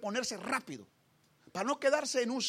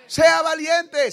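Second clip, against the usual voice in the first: slow, much louder shout of the words "Sea valiente"; preacher shouting "Sea valiente" at the congregation; shouted exhortation; 6 semitones higher, 16 dB louder, much slower than usual. Shown as a demonstration. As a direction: bellow "Sea valiente" slowly at the crowd. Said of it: "repeated louder as exhortation".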